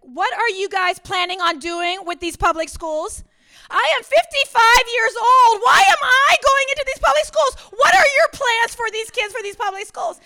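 high, loud, exasperated yelling; fed-up pastor yelling at a colleague. Retold or imitated. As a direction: demand answers at the top of your voice. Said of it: "I mean, I'm just yelling at him".

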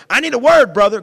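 high, loud mock plea; mock pleading shout. Church folk begging the preacher, a loud mock plea. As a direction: beg loudly for a word.

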